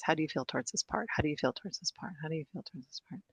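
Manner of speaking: quick, hushed repetition trailing away; fading refrain. Shown as a demonstration. Teacher echoing the question that stays all process, quiet refrain.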